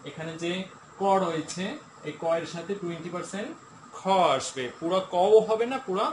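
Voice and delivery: ordinary talk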